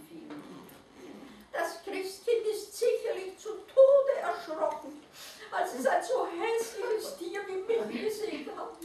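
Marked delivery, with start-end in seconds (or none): 1.5-8.8 high, small, self-pitying creature voice; sad little naked mole rat. A narrator's reading. Mole rat alone in the snow, high and forlorn. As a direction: pipe it up like a tiny unhappy animal. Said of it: "sagte der kleine Nacktmull".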